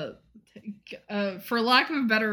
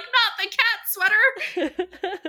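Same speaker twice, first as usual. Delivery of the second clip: much higher, slow, stretched wail; horrified cry; 9 semitones higher, slower than usual. Retold or imitated. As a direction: wail it in comic horror.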